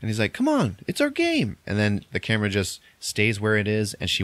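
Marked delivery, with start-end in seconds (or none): 0.3-1.6 high, insistent imitation of coaxing; wheedling. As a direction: push a child to play along, acted in a raised pitch. Retold uncle keeps coaxing.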